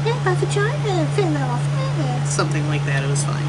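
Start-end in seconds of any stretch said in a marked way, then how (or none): 0.1-2.3 high, slow mock quote of Susan; squeaky impression of her line. Reviewer mimicking cohost's line, high and slow.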